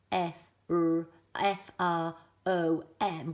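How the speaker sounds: F, R, O, M said as letter names. Shown as a demonstration. Teacher showing the wrong way with letter names. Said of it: "The name would be".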